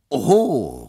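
loud, high mocking Oho; exclaiming. The lawyer teases Nippers about his changed view, loud mock exclaim. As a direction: call it out, caught off guard and teasing. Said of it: "rief ich".

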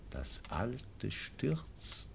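slow, breathy whispered vision; prophetic whisper. Acted. Dying baron foresees the old order falling, slow whisper.

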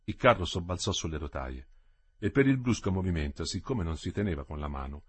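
plain reading voice

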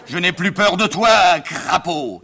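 loud, slow stage defiance; grand tragedian defying an enemy; declaiming tragic hero. Acted. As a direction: hurl a drawn-out theatrical challenge. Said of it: "papa, je répète".

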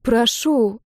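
small pleading word, lightly voiced; imploring. A narrator's reading. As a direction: beg gently. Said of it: "умоляюще глядя". (умоляюще)

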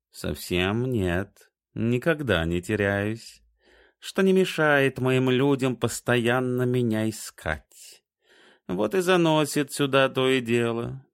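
slow, lofty talking cat. A narrator's reading. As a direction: drawl like a proud cat. Cat character voice.

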